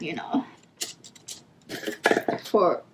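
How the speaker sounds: usual speaking voice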